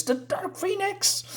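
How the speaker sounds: mock-dramatic villain reveal. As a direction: swing the pitch up for the reveal.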